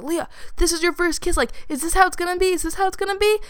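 high, rushed voice in the head; little inner voice. Retold or imitated. Little voice in her head before first kiss, high urgent questioning. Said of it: "the little voice in my head was like".

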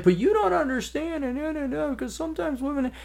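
high, pleading protest; mock whiny woman. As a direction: mimic her objection, whiny. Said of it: "this gal's like".

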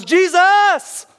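high, full-force drawn-out shout; desperate yell. Acted. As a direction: scream for him over the crowd. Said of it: "And he yells".

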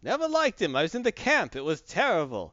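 put-on Yiddish accent. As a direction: grumble it with Yiddish lilt. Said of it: "He's never like".

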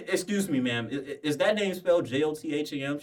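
high, hesitant, overly polite; mock politeness. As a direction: stumble through it politely. Retold polite question to teacher.

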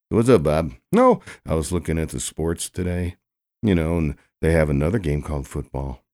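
ordinary delivery

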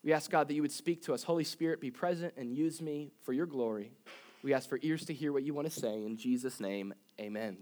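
soft, hushed prayer voice. Acted. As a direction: pray quietly to God. Closing prayer before sermon.

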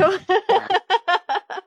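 bright burst of laughter; hearty laugh. Real, not acted. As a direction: laugh out loud at the joke. Host laughs at Marilyn joke.

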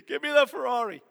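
high, loud, whiny plea for a Ferrari; mock begging. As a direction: beg like a greedy kid, voice going up. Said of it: "when you're crying out".

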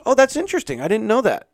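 squeaky fake-amazed receptionist; surprised receptionist. Receptionist acting amazed at his job, high mock surprise. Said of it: "she goes".

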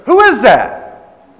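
loud, high, drawn-out challenge; imitating an outraged woman's shout; indignant demand. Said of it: "That's how she said it".

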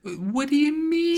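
high, drawn-out squeal of disbelief; mock outrage. Co-host reacts to a rant against New York, mock outraged. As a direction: squeak it out, aghast for fun.

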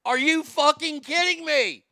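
loud, high, disbelieving outburst; outraged shout. Real, not acted. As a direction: yell it in disbelief. Outraged at the clip.

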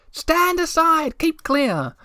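very high, slow yelled warning; shouted warning. A narrator's reading. Egg cozy on a sliding tray warning Moomintroll, shouting high. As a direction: call it out as you come coasting down. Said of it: "and it shouted".

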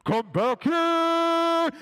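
huge, high, long-drawn holler; commanding shout. Retold or imitated. Man chasing a runaway child, booming yell. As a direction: bellow it and stretch the last word.

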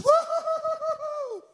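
very high, long rippling whoop; excited boy's whoop. Retold or imitated. Little boy rejoices at the miracle, high rippling whoop. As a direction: whoop like a thrilled little kid.

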